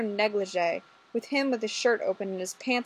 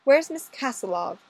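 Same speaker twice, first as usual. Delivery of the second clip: higher, louder demanding question; demanding inspector; 4 semitones higher, 5 dB louder than usual. Acted. Inspector demanding of the constable, a higher demanding question. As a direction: demand an answer from him. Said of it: "Inspector Thatcher demanded".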